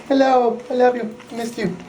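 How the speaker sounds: high, sweet pet-talk voice; squeaky loving greeting. Real, not acted. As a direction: coo at the dog.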